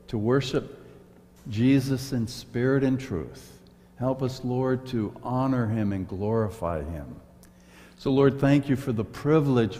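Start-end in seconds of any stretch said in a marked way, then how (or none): none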